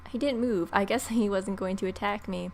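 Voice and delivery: ordinary talk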